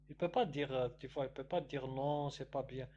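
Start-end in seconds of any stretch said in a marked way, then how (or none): none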